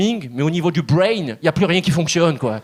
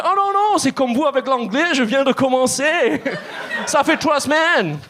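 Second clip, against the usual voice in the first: much higher, amused salesman voice; salesman's reply; 7 semitones higher than usual. Retold or imitated. The salesman teasing the comic about his English, high and amused. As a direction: tease him with a bright, high reply. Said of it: "Il me dit".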